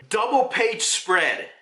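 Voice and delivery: normal talking voice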